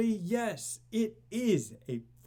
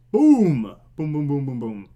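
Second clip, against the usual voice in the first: loud boom; 4 semitones lower, 9 dB louder than usual. Shown as a demonstration. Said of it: "Crank it up".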